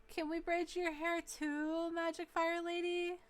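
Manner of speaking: put-on high, slow, loud child voice; little kid voice. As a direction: ask it like an eager small kid. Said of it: "one of the little kids ... says".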